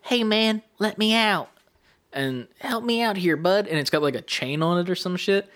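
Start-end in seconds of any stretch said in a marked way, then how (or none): 0.0-1.5 raised-pitch voice of a little captive; pleading ring voice. Retold or imitated. Talking ring calls to a player to be freed, pleading ring voice. 2.6-3.7 higher character voice asking a favour; pleading ring voice. Retold or imitated. Trapped ring asks the player for help, pleading ring voice.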